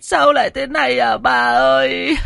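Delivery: high, loud wailing cry for her mistress; wailing sobs. Acted. Girl wailing for her dead mistress while running, sobbing high and loud. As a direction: howl out her grief as she runs. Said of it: "vừa chạy vừa gào khóc".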